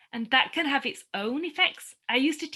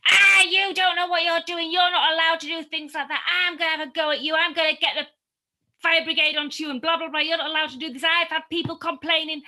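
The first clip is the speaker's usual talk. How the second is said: loud, raised, furious yelling over a wall; angry neighbour shouting; 7 semitones higher, 8 dB louder than usual. Retold or imitated. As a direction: bark at them like an angry man telling them off. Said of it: "he was screaming and shouting at us".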